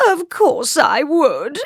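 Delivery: high, slow, loud wail; tearful high royal protest; king's cry. A narrator's reading. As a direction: cry it out in drawn-out misery. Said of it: "cried the king".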